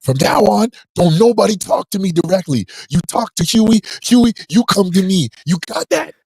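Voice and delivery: hammed-up Frank Lucas laying down the law; father doing Denzel's American Gangster speech to his baby. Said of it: "when Denzel was like".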